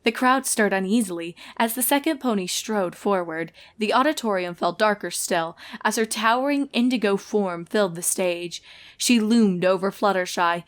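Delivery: ordinary delivery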